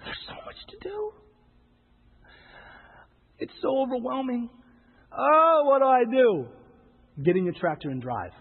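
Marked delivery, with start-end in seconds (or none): 0.0-6.5 a high, hammed-up whine of helplessness; higher, slowing mock despair. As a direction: sigh it out as if the task is too much. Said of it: "we as Christians look out into the world and we say".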